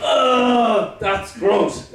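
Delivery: acted groan of disgust, then plain verdict. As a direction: recoil with a mock "ugh". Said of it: "it's disgusting".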